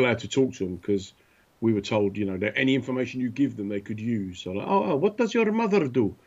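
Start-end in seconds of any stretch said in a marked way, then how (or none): 4.7-6.1 high, lightly voiced nosy local asking; mock local voice. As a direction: put on a prying local's voice. Mocking locals questioning soldier.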